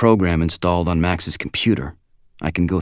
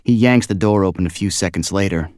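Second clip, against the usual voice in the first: plain reading voice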